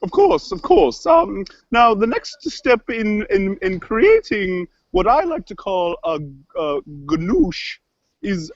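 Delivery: kooky cook character. Acted. Cook explains the gnouche recipe to her co-host, kooky voice. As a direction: stretch key words theatrically, pitch lurching. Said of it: "it's that right there".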